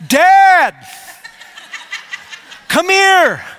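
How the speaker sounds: high, loud call across the house; child calling out. Retold or imitated. Son calling his dad from across the house, a high loud call. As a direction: yell for dad from the other room.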